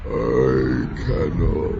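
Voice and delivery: low, very slow sorrowful voice; sorrowful demon. Acted. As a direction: refuse her gently and slowly. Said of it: "he says".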